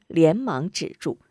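ordinary delivery

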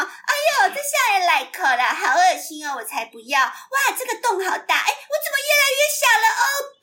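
high, whiny cartoon princess; Princess Idiot voice. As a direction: squeal it like a silly dubbed heroine. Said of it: "我那时候就很爱学她啊。".

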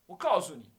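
put-on an indignant old monk pulling rank; arrogant scolding. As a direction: puff up and lecture him hard.

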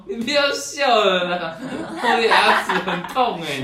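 a hammed-up child whining at the kid laughing at him; whiny hurt kid. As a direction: plead like a crying boy with a sore tooth. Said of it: "他就说".

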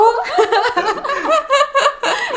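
high, real laugh. Real, not acted. Laughing at the idea of visiting grandpa for cheer. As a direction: burst out laughing.

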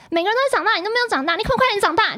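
high, loud furious scolding; angry scolding. Retold or imitated. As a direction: berate her for not growing up. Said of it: "就在那边大骂我欸".

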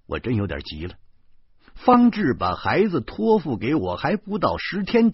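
ordinary delivery, nothing marked

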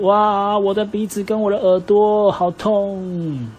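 high, slow pained wail. Acted. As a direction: moan it as if your nose was just punched. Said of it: "他就一直哇哇大叫说". (哇哇大叫，喊痛)

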